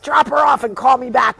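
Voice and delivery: loud, pressing command; urgent order. Retold or imitated. Husband urges his wife to hurry, loud. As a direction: rush her out the door.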